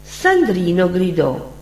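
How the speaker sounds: ordinary delivery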